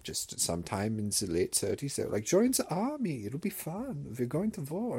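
high, hammed-up German accent, casually innocent; mock German grandpa. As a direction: play the grandpa explaining away Mein Kampf. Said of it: "and he'll be like".

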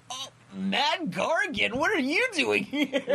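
high, startled recognition; surprised. Retold or imitated. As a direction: squeal it like you can't believe who showed up. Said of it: "I was like".

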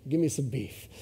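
ordinary talk

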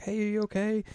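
put-on high, childlike concern; little Chibiusa. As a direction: ask like a small kid. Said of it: "and she's like".

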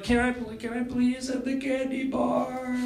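put-on high, soft, slow little-kid plea; begging child. Child begging a parent for a candy bar, pleading. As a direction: whine for the candy like a six-year-old.

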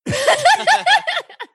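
high shriek breaking into laughter; real startled shriek that turns to giggles; scream into giggles. Real, not acted. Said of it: "you scared me".

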